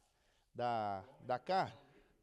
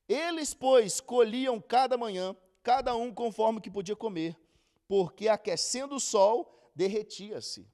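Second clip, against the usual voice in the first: slow, louder Bible reading voice; scripture reading; 3 semitones higher, 6 dB louder than usual. Acted. Teacher reading Exodus to the group, slow and solemn. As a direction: read the verses out with weight, unhurried. Reads Exodus passage aloud.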